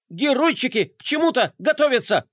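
high, breathless alarm; agitated report. Acted. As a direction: report it all worked up. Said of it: "доложил взволнованный адъютант".

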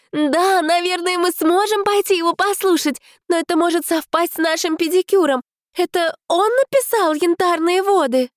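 a high, vapid society lady; airy high-pitched woman. Acted. Narrator voices Giselle. (высокий жеманный женский голос)

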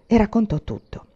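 ordinary delivery, nothing marked

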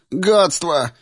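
high-pitched yelp of annoyance; exclaimed curse. Acted. Beaten opponent falling off the post, exclaimed curse. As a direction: curse as you lose your footing, pitch jumping up. Падающий противник ругается.

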